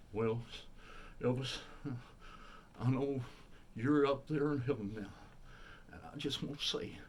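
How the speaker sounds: impersonating a typical tribute-act narration; hammy spoken tribute to a departed idol. Acted. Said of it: "doing an [name] impersonation while doing the tribute".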